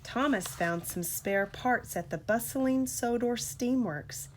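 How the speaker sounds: usual speaking voice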